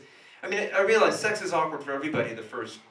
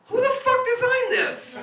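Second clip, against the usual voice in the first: shocked outburst; 7 dB louder than usual. Retold or imitated. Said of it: "you went".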